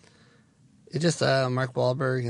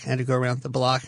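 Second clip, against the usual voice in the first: ordinary talk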